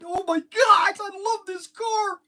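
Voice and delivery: high, squealing delight; the guy gushes over his dream car, squealing high; excited squeal. Retold or imitated. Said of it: "He's just so excited".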